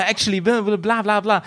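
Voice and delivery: mock business English. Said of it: "mit seinem Business-Englisch".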